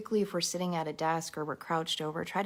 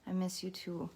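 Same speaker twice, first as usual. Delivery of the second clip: holding back tears; 3 semitones higher, 3 dB quieter than usual. Real, not acted. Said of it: "trying not to cry".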